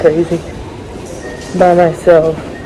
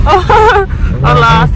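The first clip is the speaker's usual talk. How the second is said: laughing greeting; 11 semitones higher, 4 dB louder than usual. Real, not acted.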